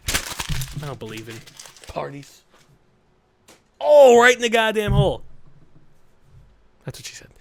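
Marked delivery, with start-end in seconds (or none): 3.8-5.2 sudden shout, higher and louder; loud exclamation at the screen; loud outburst. Real, not acted. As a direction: yell it out in mock pain. Reacting to a game moment.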